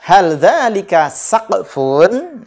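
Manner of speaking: put-on teacher reading the quiz question to kids; slow drill question. Shown as a demonstration. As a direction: read the question out slowly and clearly. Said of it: "Pertanyaan pertama".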